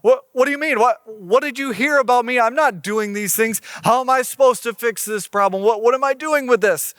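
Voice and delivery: acted panic, question tumbling after question; freaking out. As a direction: stammer and protest like an overwhelmed king. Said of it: "This king is freaking out. He's like".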